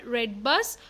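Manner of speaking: ordinary talk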